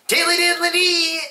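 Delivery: wild, very high-pitched squeal; hammed-up cartoon trill, way up high; Leprechaun. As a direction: squeal it like a manic imp. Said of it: "He is just wild".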